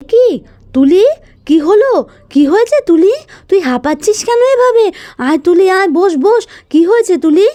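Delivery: startled aunt asking what is wrong; aunt asks the panting girl what happened; alarmed questions. Acted.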